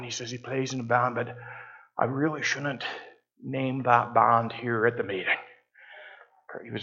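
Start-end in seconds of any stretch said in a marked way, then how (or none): 0.3-5.4 soft, low, imitated Scottish accent; reluctant Scotsman. Conservative Scotsman reluctant to name the band at church, soft Scottish accent. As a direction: confide it reluctantly in rough Scottish. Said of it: "my Scottish accent is not good".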